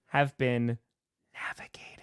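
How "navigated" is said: whispered. Pretend. GPS sign-off line.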